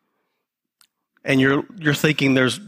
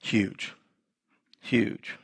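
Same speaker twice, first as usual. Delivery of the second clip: Huge, low and much softer; hushed emphasis; 7 semitones lower, 8 dB quieter than usual. Acted. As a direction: let Huge sink to a murmur. Repeated word, dropped voice.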